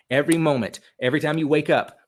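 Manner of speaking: normal talking voice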